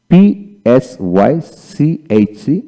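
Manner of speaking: পিএসওয়াইসিএইচসি spelled letter by letter, very slow; slow letter-by-letter spelling of পিএসওয়াইসিএইচসি; spelling out slowly. Shown as a demonstration. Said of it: "যেমন".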